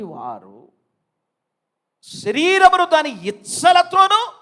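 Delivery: high, loud preacher's proclamation; ringing loud sermon climax; full-force preaching. Real, not acted.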